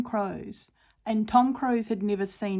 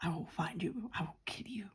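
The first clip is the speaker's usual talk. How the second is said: Liam Neeson; 2 semitones lower, 8 dB quieter than usual. Acted. Crafter playing the famous movie star's quote, whispered threat. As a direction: whisper it as a hushed, menacing film quote. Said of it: "I feel like I'm, um, that famous movie star now".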